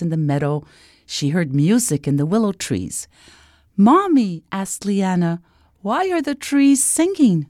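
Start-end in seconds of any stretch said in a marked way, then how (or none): 3.8-4.3 put-on high, loud little girl's call; high-pitched child seeking Mom; little princess calling. A narrator's reading. Princess calls to her mother. 5.9-7.4 put-on high-pitched wondering child; high little girl's question; curious little princess. A narrator's reading. Puzzled princess asks her mother.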